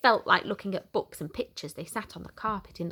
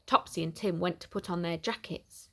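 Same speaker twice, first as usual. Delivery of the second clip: plain reading voice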